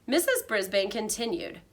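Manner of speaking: ordinary delivery, nothing marked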